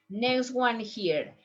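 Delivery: normal talking voice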